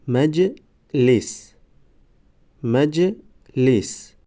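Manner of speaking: slow, syllables held and separated; slow syllable reading. Shown as a demonstration. Teacher models first readings for learners, slow syllable by syllable. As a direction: sound it out for learners, pausing between syllables. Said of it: "بار یکم و دویم آهسته".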